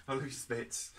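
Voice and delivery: giggling through the words; soft breathy giggle as she says it; laughing. Real, not acted. Giggling to the kids while saying goodbye. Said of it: "Oh, I'm gonna laugh now".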